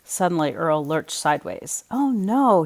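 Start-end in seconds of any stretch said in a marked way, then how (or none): 1.9-2.6 gasped. Acted. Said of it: "he gasped".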